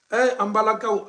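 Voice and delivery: usual speaking voice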